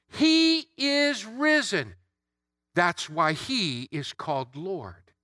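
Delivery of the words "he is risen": higher, slow ringing acclamation; Easter proclamation. Acted. Preacher giving the Easter greeting, joyful slow proclamation. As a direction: announce the resurrection with joy.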